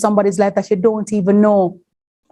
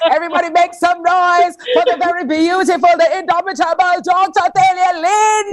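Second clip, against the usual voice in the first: hype announcer shout; 11 semitones higher than usual. Real, not acted. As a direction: pump up the crowd like an MC. Host hypes up the next speaker.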